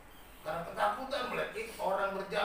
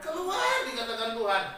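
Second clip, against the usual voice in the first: preaching at full force; 3 semitones higher, 5 dB louder than usual. Acted. Preacher proclaiming the verse to the congregation, full force. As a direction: proclaim God's promise like a revival preacher.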